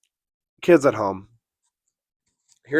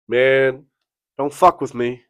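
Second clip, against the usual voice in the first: louder, higher mock tough guy; retold threat; 4 semitones higher, 7 dB louder than usual. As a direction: imitate an angry rival picking a fight. Rival threatens him at car window.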